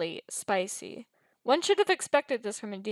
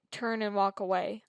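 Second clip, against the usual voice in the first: plain reading voice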